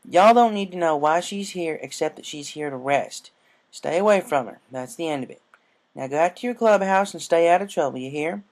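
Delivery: lightly voiced stern farm mare; firm older sister. A narrator's reading. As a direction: send the kids off firmly. Applejack orders the fillies.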